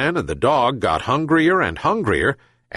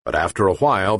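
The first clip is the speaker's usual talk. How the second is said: usual speaking voice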